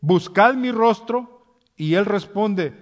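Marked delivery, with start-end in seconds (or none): 0.0-1.6 much higher, loud and slow, "Buscad mi rostro" proclaimed; "Buscad" lifted high and loud, drawn out slowly; Lord's command. Shown as a demonstration. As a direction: boom out "Buscad mi rostro" as God calling. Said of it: "El Señor nos dice".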